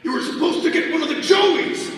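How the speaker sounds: high, loud angry shout. Acted. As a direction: shout it in frustration. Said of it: "I shouted".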